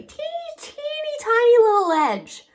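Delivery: very high, squeaky emphasis. Real, not acted. Storyteller showing how tiny the ledge is, squeaky. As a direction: shrink it into a high little squeal.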